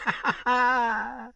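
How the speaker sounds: shrill evil witch cackle; ghastly cackle. A narrator's reading. Old witch laughing on the ground, evil cackle. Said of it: "writhed in ghastly merriment".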